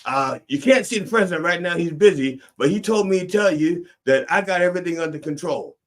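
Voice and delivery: smooth it over, all in hand; first lady covering. Retold or imitated. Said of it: "And she said".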